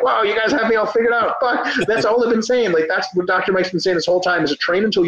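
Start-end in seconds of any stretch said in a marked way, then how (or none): 0.0-2.7 higher, mock-wounded sarcasm; sarcastic retort. Mocking critics who twist his training advice, sarcastic. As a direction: act falsely caught out, dripping irony. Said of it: "And I'm like".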